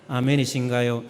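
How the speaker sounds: normal talking voice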